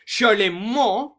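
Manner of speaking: "Charlemagne" as shar-le-MON, drawn out loud and high; French pronunciation. Shown as a demonstration. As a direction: hit the French last syllable of "Charlemagne". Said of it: "this guy's actual pronunciation of his name".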